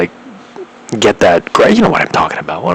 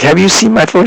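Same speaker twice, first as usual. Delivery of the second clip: frantic plea; 11 semitones higher than usual. Retold or imitated. Banging on the truck window.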